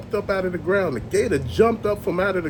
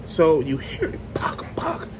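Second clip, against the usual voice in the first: mock distant pock sound; 3 semitones lower than usual. Telling friends about the woods, whispering the sound. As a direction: whisper the eerie knocking noise.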